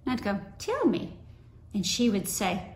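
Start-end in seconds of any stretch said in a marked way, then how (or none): 0.6-1.2 much higher, eager plea; eager little sister. Retold or imitated. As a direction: beg like a small child.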